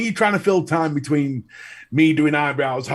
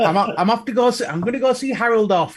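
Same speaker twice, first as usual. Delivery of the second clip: higher, put-on old lady voice; lightly voiced old lady; 6 semitones higher than usual. Old lady to her husband, playful excuse for leaving. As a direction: play a funeral-goer, half laughing.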